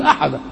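"أحدا" said with an open final a, "ahada"; pausal ending. Shown as a demonstration. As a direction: stop on "أحدا" with a long a and no n. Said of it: "لما تقف تقول". ("أحدا" بالوقف على الألف دون تنوين)

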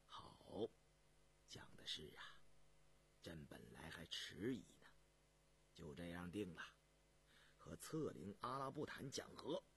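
low, hushed imperial voice; soft-spoken low monarch; emperor deciding. Acted. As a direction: rule quietly, deep in the throat. Emperor gives his decision.

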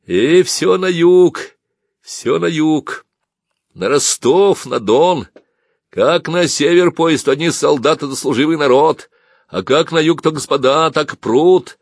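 loud, high, drawn-out exclaiming; grumbling man in crowd. A narrator's reading. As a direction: marvel aloud at the crush, stretching the words.

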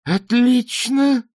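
very high, loud, stretched yell; a drawn-out furious scream; shout. Acted. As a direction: bellow it in fury. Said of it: "крикнула Шаяна".

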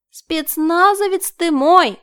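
slow, high, sugary chirp. A narrator's reading. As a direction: coo it like a cartoon princess. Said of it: "прощебетала Марина".

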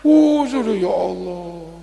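loud, slow, fed-up "uh, sudah ya Allah"; slow laughing exasperation; exasperated groan. Retold or imitated. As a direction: drag it out like a weary wife who's had enough. Wife's exasperated reply.

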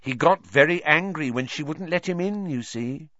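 high, relishing oily voice; oily blackmailer purr. A narrator's reading. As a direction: savor the bombshell slyly. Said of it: "cradling a large bombshell".

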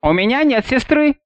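a hint of a young girl. A narrator's reading.

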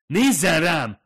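loud, high furious command; angry shout. Acted. Fumiko yells at the woman who ignores her, angry shout. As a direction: make her look at you, furious.

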